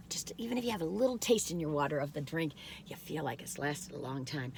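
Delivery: soft whispered aside; confiding whisper. Real, not acted. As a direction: drop to a whisper. Aside about watered drink.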